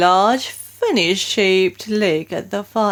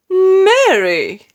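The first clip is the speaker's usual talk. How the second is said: sharp call; 11 semitones higher, 7 dB louder than usual. A narrator's reading. Queen calling her stunned maid, sharp and loud. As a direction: call her name sharply, higher and louder. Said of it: "rather sharply".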